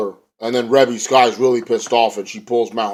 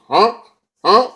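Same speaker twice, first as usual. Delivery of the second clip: short, steeply rising yelp; high questioning catchphrase; Anderson; 10 semitones higher, 4 dB louder than usual. Retold or imitated. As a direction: mimic a wrestler's upturned catchphrase.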